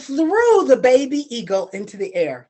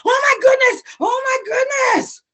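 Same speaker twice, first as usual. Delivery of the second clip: high, loud gasping exclamation; wife sees the fallen eagle, shrieking in shock; shocked cry; 12 semitones higher, 4 dB louder than usual. Acted.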